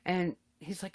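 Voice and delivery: usual speaking voice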